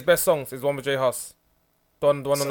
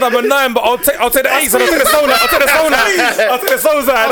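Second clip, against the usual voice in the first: higher and louder hyped shouting; loud hyped quote; 9 semitones higher, 8 dB louder than usual. Retold or imitated. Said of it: "Man said".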